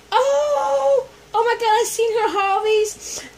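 high, loud, near tears of joy; overjoyed cry. Real, not acted. Thanking a friend for a gift, high tearful delight.

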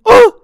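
high, loud cry of surprise; exaggerated cartoon gasp; surprised gasp. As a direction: yelp in sudden amazement. Birthday surprise revealed.

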